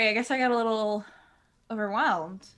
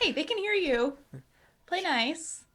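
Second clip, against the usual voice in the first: higher, teasing reprimand; mock scolding; 6 semitones higher, slower than usual. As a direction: gently chide them. Player scolds NPCs.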